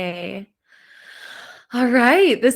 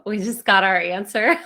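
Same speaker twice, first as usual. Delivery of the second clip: usual speaking voice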